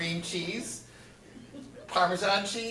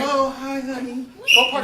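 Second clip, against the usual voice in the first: tired new mother; 5 semitones higher, 7 dB louder than usual. Acted. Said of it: "New mom voice".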